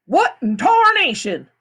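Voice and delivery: slow, high, loud cowboy exclaim; a cowboy's astonishment. A narrator's reading. The tall man in a cowboy hat exclaims, slow and loud. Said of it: "the tall man exclaimed".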